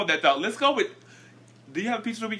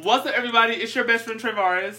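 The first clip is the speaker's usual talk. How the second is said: higher and louder, pumped-up show opener; hyped intro; 7 semitones higher, 5 dB louder than usual. Acted. The host restarts the show intro, hyped and loud. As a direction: sell the welcome like a host.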